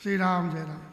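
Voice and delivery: ordinary delivery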